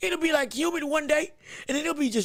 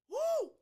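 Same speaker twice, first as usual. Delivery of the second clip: high whoo letting the fright out; a shaken high woo; relieved whoop; 8 dB quieter than usual. Real, not acted. Host after the spider scare, high whoop. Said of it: "biggest spiders I've ever seen".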